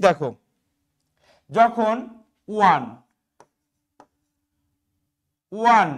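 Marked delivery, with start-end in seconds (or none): 2.5-5.9 ওয়ান opening with a w glide, like ওয়া; ওয়ান, a clear w sound at the start; pronouncing one. Shown as a demonstration. Teacher showing students that ওয়ান starts like ওয়া. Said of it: "ওর উচ্চারণটা ওয়া এর মতো হবে".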